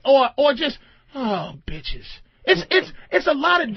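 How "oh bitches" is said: oh, bitches dropped low and soft; low fond bitches. Shown as a demonstration. Showing inflections, a low soft sigh. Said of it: "different inflections of bitch".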